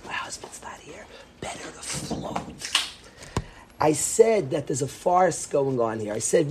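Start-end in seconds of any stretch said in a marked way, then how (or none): 0.1-2.7 whispering. Retold or imitated. Mother whispering while her son is in the bathroom. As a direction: whisper it behind the son's back. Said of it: "She whispered".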